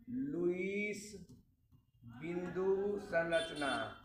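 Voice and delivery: very slow, drawn-out naming of the term. Shown as a demonstration. Teacher introducing a new term to students, very slow. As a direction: say the new term slowly and clearly. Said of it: "उसको बोलते हैं Lewis संरचना".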